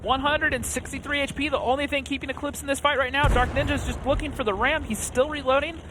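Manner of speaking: high, tense, fast-building call; excited play-by-play. Real, not acted. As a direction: keep the tension up to the kill. Caster counts down the final fight.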